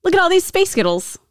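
high-pitched mock Scottish; mock Scottish accent. Shown as a demonstration. Host demonstrating a sound-alike for co-host, mock Scottish. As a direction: put on a high Scottish lilt. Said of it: "is Space Girls in Scottish".

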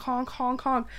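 usual speaking voice

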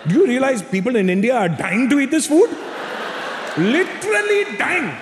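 high, strained scolding mother; mock a mother's indignation, drawing out the last words; imitated outraged mom. Mother scolds son refusing Indian food, high mock outrage. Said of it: "Mom goes".

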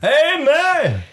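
very high, loud amen; high, loud drunken amen. Acted. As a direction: shout it back like a congregation. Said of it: "Can I get an amen?".

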